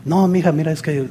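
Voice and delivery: soft, placating reply. Acted. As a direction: answer the shouting very quietly. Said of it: "tú baja la voz".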